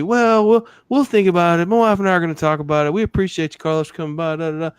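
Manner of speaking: high-pitched, noncommittal brush-off; mock polite stalling. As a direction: imitate a customer sweetly putting off the salesman. Voicing prospects politely putting salesman off.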